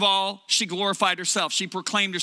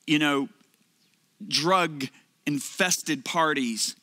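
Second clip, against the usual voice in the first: lower, slow and grave as God speaking; 5 semitones lower, slower than usual. Retold or imitated. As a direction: speak as a calm father correcting his son. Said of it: "He said".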